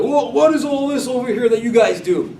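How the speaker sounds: mock them with the question; scoffing. Wicked son scoffs at the family's Passover rites, scoffing. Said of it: "a scoffing question".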